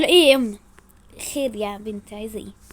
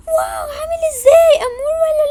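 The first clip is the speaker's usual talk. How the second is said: high, loud giggling squeal; high giggly excitement; excited squeal; 4 dB louder than usual. Acted. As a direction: squeal over the crush. Friends squeal over crush.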